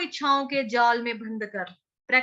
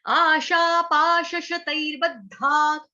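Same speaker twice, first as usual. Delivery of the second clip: slow recitation; 2 semitones higher, 3 dB louder, much slower than usual. Shown as a demonstration. Teacher reciting the shloka slowly for students. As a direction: chant आशा पाश शतैर्बद्धाक slowly, syllable by syllable. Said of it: "ध को हमने झटके से छोड़ना है".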